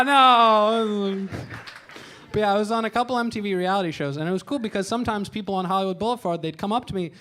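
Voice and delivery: usual speaking voice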